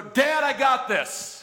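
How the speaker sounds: drawn-out mock child refusing help; stubborn child. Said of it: "and they'll go".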